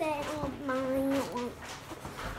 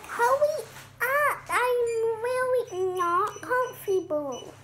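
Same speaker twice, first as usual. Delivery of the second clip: high, slow whiny crab; little crab voice; 7 semitones higher, slower than usual. Acted. Crab complaining about the bed, high drawn-out whine. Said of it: "He like".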